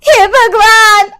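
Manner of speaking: very high, loud, slow crying wail; wailing housewife. Acted. Robbed woman wails. (ऊँची, धीमी, रोती हुई चीख़)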